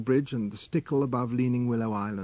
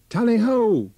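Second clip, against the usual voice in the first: high, loud huntsman's call; hunting cry; 8 semitones higher, 6 dB louder than usual. A narrator's reading. Old huntsman hollering to the pack from the island. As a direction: sing it out across the river to the hounds. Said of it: "Tarka heard a cry".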